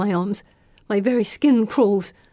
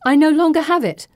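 higher-pitched, emphatic protest; insistent; 7 semitones higher, 4 dB louder than usual. A narrator's reading. Heroine to her armed pursuer, insistent. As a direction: insist under threat.

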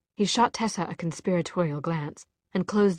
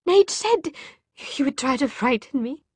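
plain reading voice

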